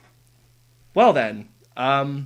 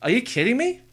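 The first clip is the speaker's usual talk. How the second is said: high, rising disbelief; astonished question climbing at the end; real incredulity; 9 semitones higher than usual. Real, not acted. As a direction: ask it high-pitched, unable to believe the news. Still stunned by the news.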